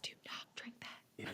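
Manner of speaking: barely audible whispered warning; whispering mom. Retold or imitated. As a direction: imitate a mom's hushed warning. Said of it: "every mom is whispering that".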